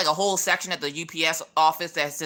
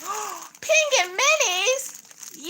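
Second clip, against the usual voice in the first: gasp, then a high, very slow squeal of the words; thrilled at spotting a favourite; high squealing delight over penguins; much slower than usual. Real, not acted. Gasps unboxing penguin gift.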